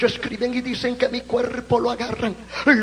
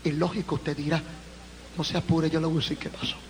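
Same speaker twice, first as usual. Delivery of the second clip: hushed aside; 9 semitones lower, 11 dB quieter, much faster than usual. Real, not acted. Intimate aside to audience.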